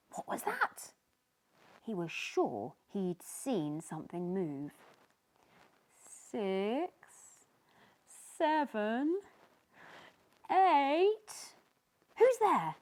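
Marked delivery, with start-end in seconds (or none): 0.1-0.9 high, whispered fright; startled. Acted. Sneezy startled by movement, whispered and high. 6.3-11.5 building fear. Acted. Sneezy counting, getting more scared. 12.2-12.7 high, loud call into dark; yelled. Acted. Sneezy yelling into the dark shipwreck. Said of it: "he yelled into the darkness".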